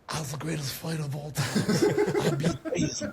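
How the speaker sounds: a light, laughing take on Muhammad Ali's boasting; playful Ali boast said to Ali himself, laughing through it; Muhammad Ali impression. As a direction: brag like the champ, half laughing. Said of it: "I do my best Muhammad Ali impersonation".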